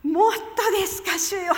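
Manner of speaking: high, loud astonished reply; surprised protest. Retold or imitated. (驚いて聞き返す)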